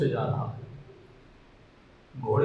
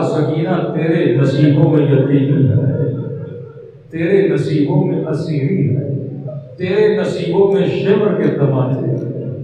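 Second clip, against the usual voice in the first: slow and much louder, a wailing cry; weeping lament; 10 dB louder, slower than usual. A narrator's reading. Husain weeping over Sakina's fate, slow loud wailing lament. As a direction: mourn her fate slowly, each line a cry. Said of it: "اور رو کر کہا".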